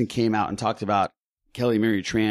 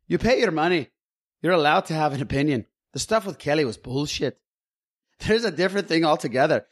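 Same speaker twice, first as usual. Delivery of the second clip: higher, lilting attempt at a Scottish accent; bad Scottish accent; 4 semitones higher than usual. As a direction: read it out in a dodgy Scottish brogue. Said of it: "that's not Scottish".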